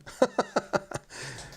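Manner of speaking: high, chuckling burst of laughter; amused laugh. Real, not acted. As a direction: laugh at your own slip. Laughing at own Scouse-sounding pronunciation.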